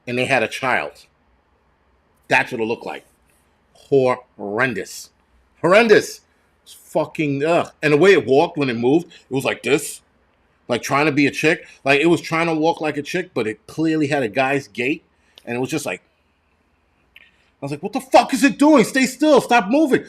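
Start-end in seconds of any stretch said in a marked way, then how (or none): 3.7-5.1 HORRENDOUS stretched out very slowly; drawn out. Real, not acted. Repeats verdict, first drawn out. 5.6-6.2 HORRENDOUS punched out high and loud; emphatic repeat. Real, not acted. Repeats verdict, now emphatic. 17.8-20.0 high-pitched exasperated yelling; mad outburst. Retold or imitated. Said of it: "I was so mad, man".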